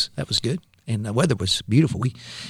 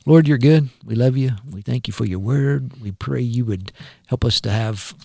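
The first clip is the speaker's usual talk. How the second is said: prayer; 5 dB louder than usual. Acted. Leads a prayer.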